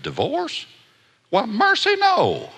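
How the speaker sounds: put-on high, prim old lady; old grandma voice. Preacher quoting the grandma's shocked answer, high old-lady voice.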